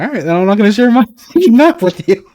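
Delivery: high, loud laughing retort; mock indignant. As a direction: protest through your own laughter. Mock spat with clanmates, laughing.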